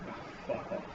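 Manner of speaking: whispered. Real, not acted. Muttering in disgust.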